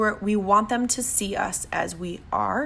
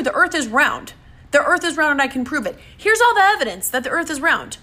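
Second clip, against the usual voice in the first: much higher, louder, panicky pleading; mock frantic arguing; 7 semitones higher, 4 dB louder than usual. Arguing with a flat earther in the street, hammed-up frantic pleading. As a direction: ham up a desperate argument you cannot win. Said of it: "run over to them and say".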